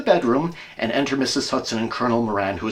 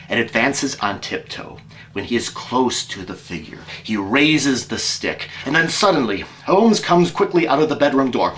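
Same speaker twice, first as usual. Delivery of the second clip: ordinary talk, nothing marked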